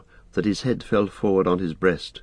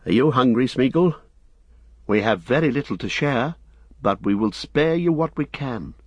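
higher, kindly hobbit voice; Frodo, kindly; 5 semitones higher than usual. Acted. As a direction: offer to share, in a higher lilting voice.

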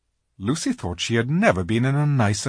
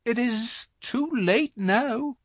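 melancholy; 9 semitones higher, 5 dB louder than usual. A narrator's reading. Faun regrets his geography to Lucy, melancholy. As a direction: sigh it out as a regretful little faun. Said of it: "in a rather melancholy voice".

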